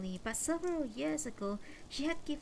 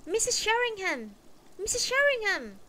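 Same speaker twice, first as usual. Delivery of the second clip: high, louder call from down the road; 11 semitones higher, 8 dB louder than usual. Acted. Mrs Waters calls after the narrator in the street, calling out louder and higher. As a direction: call after her to make her turn. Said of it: "called from behind us".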